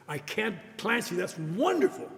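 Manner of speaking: rising, delighted praise; gushing praise. Retold or imitated. Boss gushing praise, retold.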